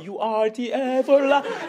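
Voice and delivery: "everlasting" high and stretched out, mouth rounded; over-pronounce "everlasting" slowly, round-mouthed and hammed-up; exaggerated enunciation. Preacher parodying a worship singer, stretching "everlasting" with rounded vowels. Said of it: "입을 뭐 동그랗게 벌려가지고 발음을 막".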